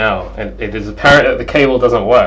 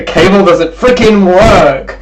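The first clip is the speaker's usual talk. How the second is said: higher, punch freaking work; exasperated outburst; 7 semitones higher, 4 dB louder, slower than usual. Real, not acted. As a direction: burst out in frustration. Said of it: "I'm so mad".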